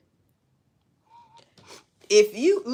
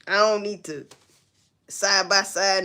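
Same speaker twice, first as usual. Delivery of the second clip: ordinary talk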